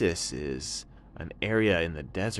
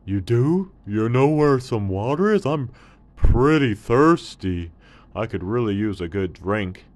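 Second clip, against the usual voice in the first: lightly voiced surprised camel, louder; a thirsty camel perking up; hint of a thirsty camel; 4 semitones higher, 10 dB louder than usual. Camel voice in story.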